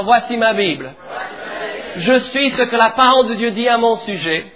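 loud, measured declaration for the church to repeat; fervent preacher leading a call-and-response; preaching declaration. Acted. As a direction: proclaim each phrase for the congregation to echo. Congregation repeats declaration after preacher.

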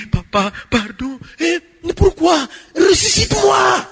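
pleading cry rising to a shout; a desperate plea building into a high shout. Retold or imitated. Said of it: "avec des grands cris".

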